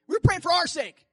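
preacher's sudden loud emphasis; emphatic. Real, not acted. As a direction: burst out with feeling. Emotional sermon testimony.

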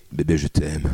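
low, mock-tender last words; overacted death scene. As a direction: play dying words to a girlfriend. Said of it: "faut pas que ce soit les derniers mots".

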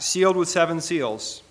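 ordinary talk, nothing marked

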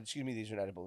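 soft, meek excuse me; timid quiet. Retold or imitated. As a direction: say it timidly, barely there. Retold timid complaint.

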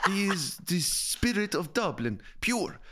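high, slow mock Spanish-sounding accent. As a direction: proclaim it in an imitated Spanish-sounding accent, drawing it out. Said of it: "I don't know why she sounds Spanish".

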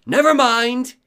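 high, loud snap; angry villain shout. Acted. Villain cutting off the talk, high loud shout. Villain snaps, leaves for massacre.

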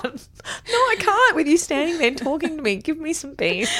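high-pitched hammed-up protest; mock exasperation. Pestered on the toilet by someone hurrying, mock exasperated. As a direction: playfully act fed up and pestered. Acting out being hurried on toilet.

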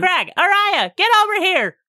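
put-on high, loud child calling friends over; yelling names. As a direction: yell across the room like an excited kid. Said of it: "You can't just yell our names".

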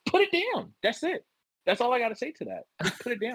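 high-pitched, insistent "put it down"; emphatic fatherly order. Real, not acted. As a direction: lean hard on "put it down" like a dad at his limit. Father addressing daughter via podcast.

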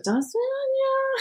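put-on very high, slow singsong child voice; imitating toddler's Russian goodbye. Mum imitates daughter going, high singsong toddler goodbye. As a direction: pipe it sweetly like a small child. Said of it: "when she's going, she's like".

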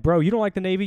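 proud indignation. Retold or imitated. Recruiter defending the Navy to dad, indignantly proud. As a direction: puff up and challenge him. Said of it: "this weird sense of Navy pride".

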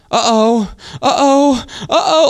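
high, loud little uh-ohs climbing each time; mock child panic. Dad imitating daughter when an ad interrupts her show, panicking. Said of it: "she starts panicking".

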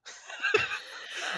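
a high, unvoiced wheeze of laughter; airy squeaking laugh; high breathless laugh. Real, not acted. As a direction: laugh silently at the memory. Laughing at the memory.

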